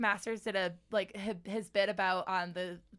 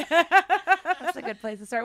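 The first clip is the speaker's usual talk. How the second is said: laughing through the words; 8 semitones higher, 11 dB louder than usual. Real, not acted. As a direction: say it while cracking up. Laughing at joke.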